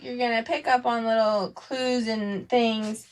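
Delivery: ordinary delivery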